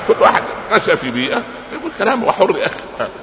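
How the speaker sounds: laughing lightly, voice lifted high and fast. Real, not acted. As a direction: chuckle through the words. Laughs about dialects. (يضحك وهو يتكلم بسرعة)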